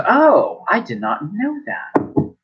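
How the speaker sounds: grandmother caught out, surprised and slowing down. Acted. Grandmother learns the tower's secret, surprised then softening.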